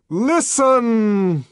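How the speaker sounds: high, loud, long-held command; startling loud clarion call; trumpeting. A narrator's reading. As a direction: trumpet it out, holding the last sound. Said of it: "trumpeted the voice".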